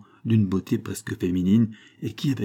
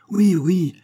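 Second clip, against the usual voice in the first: loud, high eager cry; children's shout; 10 semitones higher, 6 dB louder than usual. A narrator's reading. Schoolboys backing their comrade, shouting. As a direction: shout agreement high-pitched. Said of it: "crièrent les enfants".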